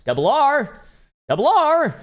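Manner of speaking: loud, high-pitched holler; calling out. Real, not acted. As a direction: shout the name out to find someone. Calling out for a missing viewer.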